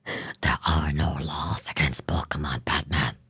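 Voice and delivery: rasping, whispered growl; very low, breath-heavy superhero rasp; Batman. Acted. Streamer playing Joker to Batman, whispered deep growl. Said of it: "That's Batman's voice, not Joker's".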